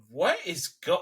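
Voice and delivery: baffled, incredulous viewer; exasperated disbelief. Retold or imitated. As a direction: question it in mock bewilderment. Acts out baffled viewer reaction.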